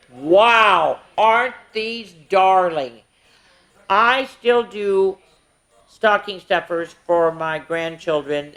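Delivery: lively sing-song counting rhyme; reading to children. Acted. Miss Tasha reading a Christmas counting book to kids, sing-song. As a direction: read it out brightly, verse by verse for the little ones.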